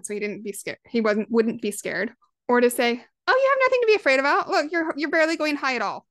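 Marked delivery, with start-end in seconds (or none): 3.3-6.1 high-pitched, hurried brushing-off of fear; acted-out over-eager reassurance, pitched up; knee-jerk dismissal. Parent telling scared child on swing, dismissive. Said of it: "my knee-jerk instant reaction was to be like".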